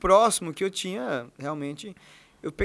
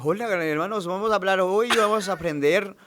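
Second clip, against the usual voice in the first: put-on Spanish accent; 7 dB louder than usual. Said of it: "qual a sua voz em espanhol?".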